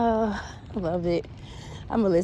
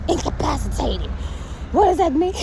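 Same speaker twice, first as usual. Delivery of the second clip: higher, indignant disbelieving question; mock outrage; 8 semitones higher, 3 dB louder than usual. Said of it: "I was mad".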